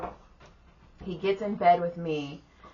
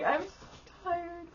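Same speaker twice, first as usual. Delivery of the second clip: mock exhausted whine; 12 semitones higher, 6 dB quieter than usual. Acts out exhausted reply at work.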